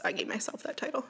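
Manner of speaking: whispered, unvoiced confession; whispered aside. Real, not acted.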